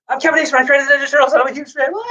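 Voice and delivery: high, gushing fanboy voice; starstruck fan. Retold or imitated. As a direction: squeal it to your childhood hero. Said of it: "And I said".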